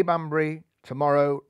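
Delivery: ordinary delivery, nothing marked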